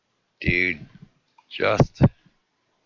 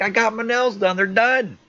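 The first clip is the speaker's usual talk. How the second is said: high-pitched, loud mock girlfriend; indignant retort; 7 dB louder than usual. Girlfriend defends herself about her nails, indignant retort. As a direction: imitate her snapping back at the top of the voice. Retold girlfriend argues back.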